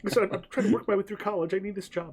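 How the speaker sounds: a student waiter begging to keep his job; pleading waiter. Acted.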